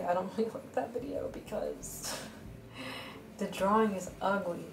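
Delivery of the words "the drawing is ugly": usual speaking voice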